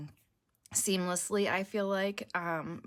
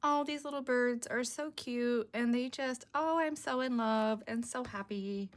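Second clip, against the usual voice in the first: higher, cooing lovestruck voice; lovestruck woman; 4 semitones higher than usual. Acted. Crafter voices the pictured woman gazing at love birds, lovestruck. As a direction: coo over it in a raised pitch. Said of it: "they're little love birds".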